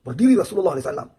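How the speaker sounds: preacher rising loud and fast on the repeat. A narrator's reading. Same line repeated with force.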